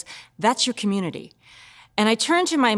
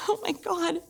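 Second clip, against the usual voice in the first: high, small, softer voice; quiet despair; 8 semitones higher, 6 dB quieter than usual. Acted. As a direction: shrink into a horrified little plea. Despair after compulsive swearing.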